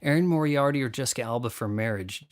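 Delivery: ordinary talk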